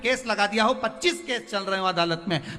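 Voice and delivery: high-pitched, driving sermon appeal; impassioned preaching. Real, not acted. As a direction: pile up the examples with rising urgency. Preaches with raised intensity.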